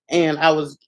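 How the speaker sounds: ordinary talk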